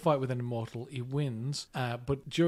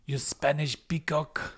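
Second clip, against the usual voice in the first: slow, put-on insult from the film; mock film-character voice; 3 semitones higher, slower than usual. Quoting the film to co-host, slow put-on character voice.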